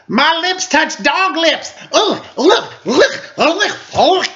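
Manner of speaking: put-on high, slow, disgusted little girl voice; grossed-out Lucy. A narrator's reading. Lucy after bobbing apples with Snoopy, high disgusted girl voice. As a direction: squeal it like lips touched dog lips. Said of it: "Blech!" she said".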